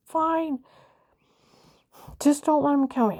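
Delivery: a touch of mock desperation; retold giving in. She retells giving in, pleading.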